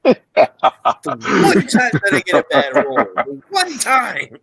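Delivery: loud laughing complaint; laughing exasperation. Real, not acted. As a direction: laugh through the protest. Laughing exasperation at bad roll.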